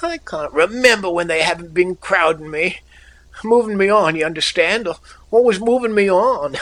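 high, plaintive complaining; querulous. A narrator's reading. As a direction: whine it like a bewildered child. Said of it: "His voice was querulous".

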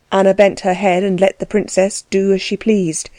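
ordinary delivery

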